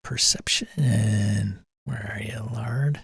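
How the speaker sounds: breathy whisper, very soft. Pretend. Player whispering while searching for the sneaky Lard. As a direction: hush it like you're hunting.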